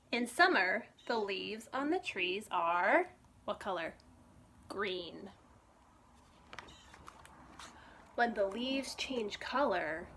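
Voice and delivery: bright, lively storybook reading; reading to children. Acted. Librarian reading a picture book to children, lively storytime voice. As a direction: read it like storytime for little ones.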